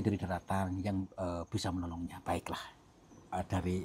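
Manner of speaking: hushed whisper to himself; quiet whispered okay. Real, not acted. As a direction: breathe it out softly. Ghost hunt, whispered aside.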